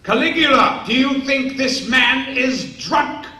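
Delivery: a mad emperor toying with a guard; imperious emperor. Acted. Emperor asking Caligula about the sentry, loud and regal.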